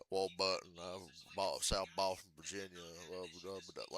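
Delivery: low Southern mumble, trailing into gibberish; Ward Burton. Retold or imitated. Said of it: "his voice is like that".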